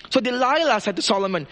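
ordinary talk, nothing marked

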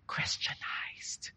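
slow, drawn-out whisper; hushed, stretched-out reveal; conspiratorial whisper. Acted. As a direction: whisper it like a sly secret. Mock secretive punchline, whispered.

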